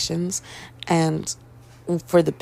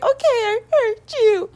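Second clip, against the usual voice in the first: high, cracking voice on the edge of crying; high teary okay; 4 dB louder than usual. Retold or imitated. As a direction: squeeze it out through tears. Retelling near tears at vet.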